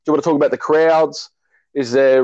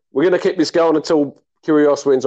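ordinary delivery

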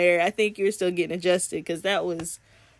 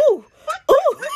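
high, louder squeals through laughter; laughing coo of delight, much higher; giggly ooh; 4 dB louder than usual. Retold or imitated. Recalling touching Matt's chest, squealing and laughing. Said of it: "I was like".

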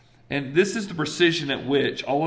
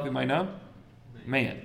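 normal talking voice

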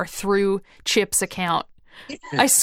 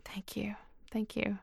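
soft unvoiced whisper; 19 dB quieter, slower than usual. Real, not acted. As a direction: breathe the thanks almost silently. Whispered thanks at show end.

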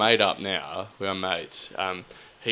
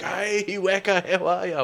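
much higher, slow cheery greeting; high friendly bloke voice; 10 semitones higher than usual. Retold or imitated. Tall guy greets Weka at the club, high and cheery. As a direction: play the big bloke greeting a mate.

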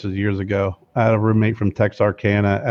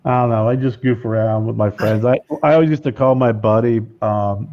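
usual speaking voice

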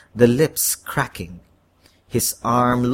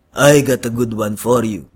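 Dolphy; 4 dB louder than usual. A narrator's reading. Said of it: "Dolphy said".

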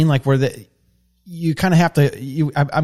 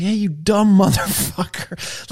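mock yell; 7 semitones higher, slower than usual. Imitating someone yelling at the apprentice on the job, mild mock yell. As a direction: imitate a job-site chewing-out, half laughing. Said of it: "I've been yelled at on the job".

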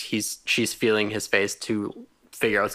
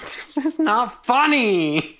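high, drawn-out whine; laughing protest; 11 semitones higher, much slower than usual. Real, not acted. Co-host objects to laughing at the death, high and drawn out. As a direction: stretch it out in a high, helpless protest.

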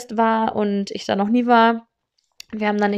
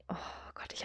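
whisper; 23 dB quieter, faster than usual. Real, not acted. Remembering the bloody fall.

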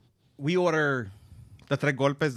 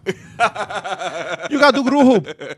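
put-on loud, high Dominican accent; 9 semitones higher, 8 dB louder than usual. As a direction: imitate the lady on the phone. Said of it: "She's trying to say Grubhub".

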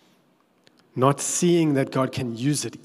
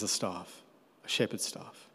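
much softer, half-whispered reply; hushed Moses; 6 semitones lower, 11 dB quieter than usual. Retold or imitated. Moses answering God in a small whispered voice. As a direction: shrink back and breathe it out.

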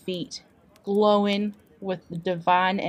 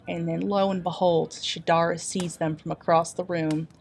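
ordinary talk

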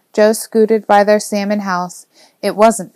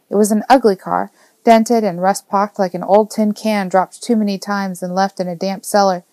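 ordinary delivery, nothing marked

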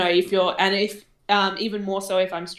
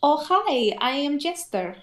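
put-on high Eastern European accent; Jester; 6 semitones higher than usual. Demonstrating the accent on request for co-host, high Eastern European accent. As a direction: pitch it up in a Russian-style accent. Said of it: "what was that Jester one again".

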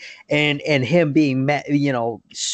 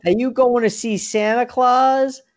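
imitating a movie kid; 9 semitones higher, 4 dB louder than usual. Kid in line asking about Santa, high imitated voice. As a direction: ask it high, like a child in line.

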